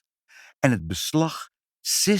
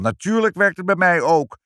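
angry boast; 7 semitones higher, 5 dB louder than usual. A narrator's reading. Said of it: "riep de boze man".

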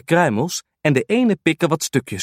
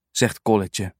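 ordinary delivery

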